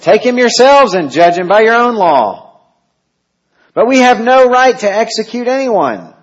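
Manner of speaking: high, loud declaiming of the dialogue; dramatic reading. Acted. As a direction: throw each quote out to the hall.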